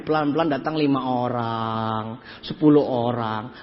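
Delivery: very low, drawn-out words; slow build. Real, not acted. Speaker telling audience how guests trickled in, low and slow. Narrating slowly growing attendance.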